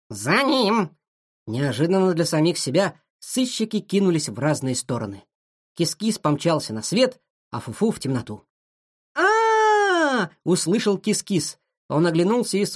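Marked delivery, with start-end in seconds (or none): 0.1-1.0 higher, urging cry; urgent chase call. A narrator's reading. Dog urges the chase. 9.1-10.3 long, very high cry of alarm; high-pitched scream. A narrator's reading. Scream heard in the tunnel.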